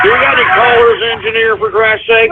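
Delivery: ordinary delivery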